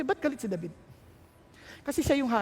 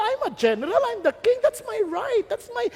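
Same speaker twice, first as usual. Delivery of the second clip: higher, louder self-justifying boast; proud king; 9 semitones higher, 7 dB louder than usual. Retold or imitated. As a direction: puff up like an entitled ruler. David justifying himself as king. (mayabang na hari)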